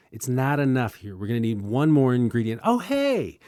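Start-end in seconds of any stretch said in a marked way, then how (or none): 2.6-3.4 high-pitched fake delighted discovery; mock surprise. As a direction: pretend you just stumbled on it. Sarcastic discovery of convenient plot device.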